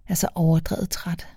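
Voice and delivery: soft breathy sigh of tiredness; exhausted whisper. A narrator's reading. Drunk girl coming home, whispered exhaustion. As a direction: whisper it half asleep.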